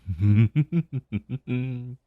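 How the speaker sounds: a knowing, self-satisfied laugh; dragon laugh. Acted. Dragon mocks mage's gift.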